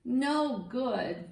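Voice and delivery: plain reading voice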